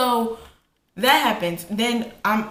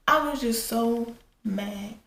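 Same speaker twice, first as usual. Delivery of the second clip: usual speaking voice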